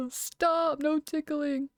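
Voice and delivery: hammed-up ticklish beast; laughing monster. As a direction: plead through put-on monster laughter. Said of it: "bellowing laughter".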